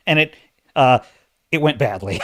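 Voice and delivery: ordinary talk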